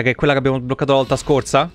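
usual speaking voice

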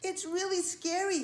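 high, worried spider voice; frightened spider. A narrator's reading. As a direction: warn them with a touch of fear. Frightened spider warns other bugs.

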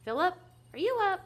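high, loud mother's call; calling upstairs. Acted. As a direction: call up to a child in bed. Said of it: "called upstairs".

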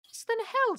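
put-on tiny high-pitched boy voice; alarmed small boy. A narrator's reading. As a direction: squeak the question like a worried child. Frightened boy questions the master.

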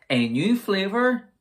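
excited. Real, not acted. Excited product announcement.

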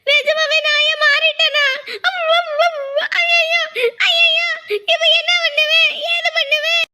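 a wife shrieking that she has turned into a dog; mock panic. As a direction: shriek for help in comic terror.

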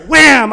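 shouted slap sound; loud spoken slap effect; loud wham. Retold or imitated. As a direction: punch out the wham.

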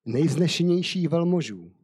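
steady storyteller's reading voice; measured Bible story narration. Acted. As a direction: read the tale aloud to listeners. Reads Esther story aloud.

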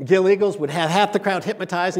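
ordinary talk

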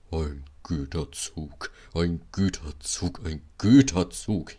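dragged-out, labouring complaint; moaning. A narrator's reading. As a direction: wail wearily like a sulking engine. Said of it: "jammerte er".

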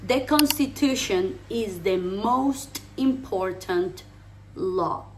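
slow teacher delivery, word by word; deliberate, spaced-out model sentence; slow English model. Shown as a demonstration. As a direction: say it slowly for learners to copy. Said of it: "La constitución es la ley más importante".

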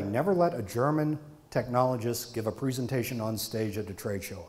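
usual speaking voice

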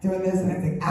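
ordinary talk, nothing marked

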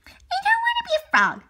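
put-on high, whiny kid frog; little frog child. Son frog whines to Daddy in a high child voice. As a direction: pipe up like a small frog child.

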